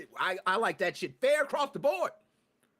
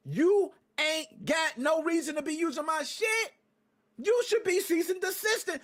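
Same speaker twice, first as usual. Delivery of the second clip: ranting shout at the company; heated shout; 6 semitones higher than usual. Real, not acted. Ranting at the brand's silence.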